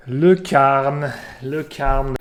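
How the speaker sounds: loud "le Karn" with a French R; mock French accent. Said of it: "wants me to speak with a French accent".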